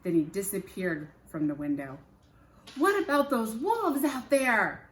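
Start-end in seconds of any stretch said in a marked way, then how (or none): 2.8-4.9 louder, higher call after him. Acted. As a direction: call across the room. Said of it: "Jack called".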